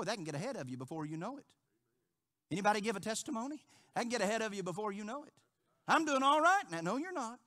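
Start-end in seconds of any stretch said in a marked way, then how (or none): 5.9-6.8 loud, high mock of a cocky believer; smug self-assurance. Preacher mocking a cocky believer, loud smug voice. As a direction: mimic someone sure they're fine.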